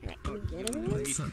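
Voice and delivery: high altered voice changer voice. Real, not acted. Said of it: "turn this shit down so I sound normal again".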